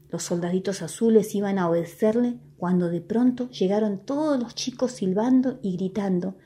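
ordinary delivery, nothing marked

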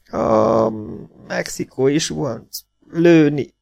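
high, broken pained voice; wounded half-breed. Acted. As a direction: force out words through the wound. (sebesült félvér, magas, töredezett hang)

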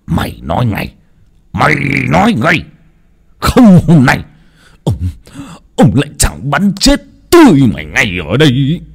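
angry threat. A narrator's reading. Father holds gun, threatens son.

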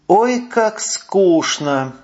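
slow, drawn-out bored sigh. A narrator's reading. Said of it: "вздохнула шатенка". (скучающе, со вздохом, протяжно)

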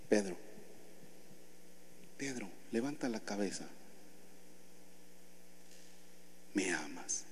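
low, soft and tender; Jesus gently asking Peter if he loves him. Acted. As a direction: ask with quiet love. Jesus asks third time softly.

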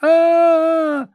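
high terrified scream; wild scream. Acted. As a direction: shriek in horror. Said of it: "с диким криком".

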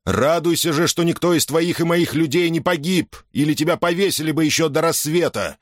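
stern angry general. Acted. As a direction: scold him like a furious general. Caesar berates Agenobarbus.

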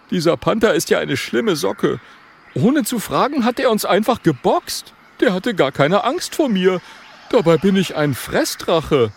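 high, punchy character voice; high-pitched miffed dragon; indignant little dragon. Acted. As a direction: complain as a small, proud dragon.

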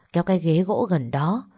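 usual speaking voice

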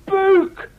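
high, loud call across the room. Acted. Beuk hailed from across the room, loud call. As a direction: call his name to catch attention. Calling out a name.

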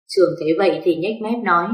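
ordinary delivery, nothing marked

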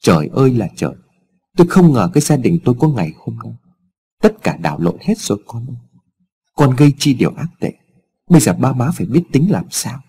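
usual speaking voice

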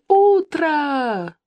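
one long, high-pitched wake-up cry; acted yelling. A narrator's reading. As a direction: stretch it out in a high holler. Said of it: "заорала Ира". (высокий протяжный крик)

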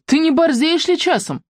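high, brash street challenge; cocky challenge. A narrator's reading. Коваль brashly challenging Белла, pitched high. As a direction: needle her like a street tough.

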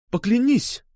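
put-on high, urgent girlish demand; insistent demand. A narrator's reading. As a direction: demand the promise on the spot. She demands an oath. (требовательно, настойчиво)

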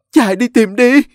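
high, loud urgent command; alarmed order. A narrator's reading. Father ordering a search for his wife, alarmed loud command. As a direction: urge everyone to search now. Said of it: "Tiếng của ông Hùng hốt hoảng".

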